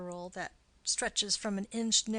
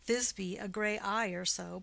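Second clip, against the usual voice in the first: ordinary talk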